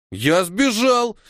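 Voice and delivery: high, slow, drawn-out protest; displeased exclaim. A narrator's reading. Tolya protesting to Kora, high sulky exclaim. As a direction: insist on it, offended. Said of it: "недовольно воскликнул Толя".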